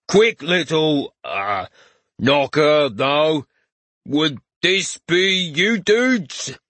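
slow drawn-out mock dude talk; imitating the hero. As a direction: drag every word, aping the hero. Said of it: "drawing out the words ... imitate the hero's speech".

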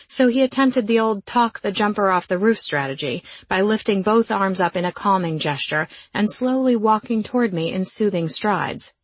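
ordinary delivery, nothing marked

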